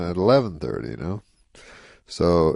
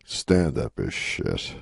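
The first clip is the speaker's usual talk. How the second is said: slow, lower flat verdict; deadpan reversal; 7 semitones lower, much slower than usual. Retold or imitated. Rodney undercutting his own advice to Norm, slow and low. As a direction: drop it slowly as a punchline. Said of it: "he pauses, and then he goes".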